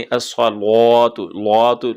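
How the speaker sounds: अस्सलातु slowly with a thin, light lam. Shown as a demonstration. Teacher showing a light lam in अस्सलातु. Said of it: "लाम को मोटा नहीं करना".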